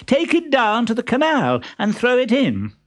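high-pitched eager order; exclaimed. A narrator's reading. Columbine orders the trunk into the canal, exclaiming. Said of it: "exclaimed Columbine".

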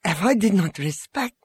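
high-pitched, mildly angry retort; understated indignant protest; unrestrained anger. A narrator's reading. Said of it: "unrestrained anger".

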